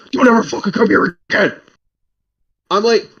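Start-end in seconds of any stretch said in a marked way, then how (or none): 0.1-1.5 mock angry yell, raised pitch; retold screaming. Landlady's son throws out apartment hunter, imitated screaming. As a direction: imitate a man furiously warning someone off. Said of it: "He starts screaming at me".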